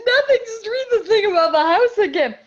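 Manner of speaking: high, loud mock-hysterical squeal; hysterical imitation. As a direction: imitate someone helpless with laughter, begging. Casting people in hysterics, retold.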